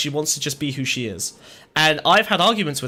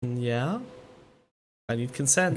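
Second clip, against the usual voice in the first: low, hushed, close-up murmur; real near-whisper, intimate and teasing; whispering in someone's ear; 5 semitones lower, 8 dB quieter than usual. Real, not acted. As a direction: softly coax the listener, pitched low. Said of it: "you don't have to whisper in my ear".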